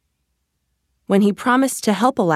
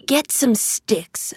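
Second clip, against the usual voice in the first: magician voice; 5 semitones higher, slower than usual. A narrator's reading. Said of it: "said the magician".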